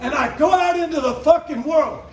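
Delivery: high, loud, punched-out stage tirade; shouted stand-up rant. Acted. Comedian signing off to a crowd, defiant shouted rant. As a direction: belt it at the crowd, hammering every swear word.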